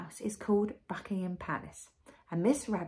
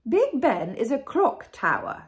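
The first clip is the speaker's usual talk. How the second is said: higher, loud regal voice; grand queen; 4 semitones higher, 8 dB louder than usual. A narrator's reading. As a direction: explain it with royal pomp. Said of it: "said the Queen".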